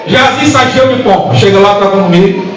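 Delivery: high-pitched, driving sermon delivery; fervent Pentecostal preacher in full cry; full-force preaching. Real, not acted. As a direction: pile up the phrases with rising fervour.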